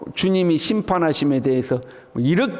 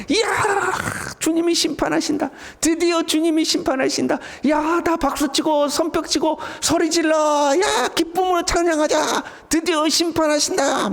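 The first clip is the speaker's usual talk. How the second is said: high-pitched jubilant cries; 10 semitones higher than usual. Acted. Believers greeting the Lord's judgment, jubilant shouting. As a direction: cry out as if long-awaited news came. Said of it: "이렇게 기뻐할 수 있을까요".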